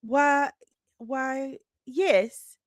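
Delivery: flustered Layla. Acted. Reporter coughs through smoke, answering Bob in a flustered high voice.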